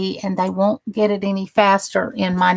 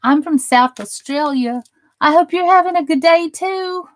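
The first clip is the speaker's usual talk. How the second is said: fast, much higher, louder Australian try; attempted Australian accent; 7 semitones higher, 3 dB louder than usual. Trying an Australian accent for a viewer and getting it wrong. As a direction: rush a clumsy Australian accent. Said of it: "I'm saying it all wrong".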